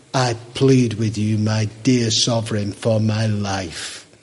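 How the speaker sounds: a condemned man pleading with his king; low, slow, desperate plea to the sovereign; prisoner's plea. Acted. Prisoner Cromwell begs the king.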